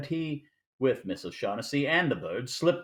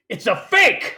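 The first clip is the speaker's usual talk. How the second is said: shocked shout; 8 semitones higher, 10 dB louder, slower than usual. Acted. Gutman discovers the fake bird.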